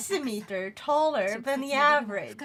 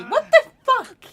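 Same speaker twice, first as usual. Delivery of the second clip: loud disbelief; 9 semitones higher, 7 dB louder than usual. Real, not acted. Outraged at sister's height.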